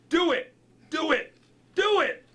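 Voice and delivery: high, loud excited screech. A narrator's reading.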